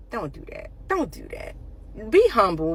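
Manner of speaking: usual speaking voice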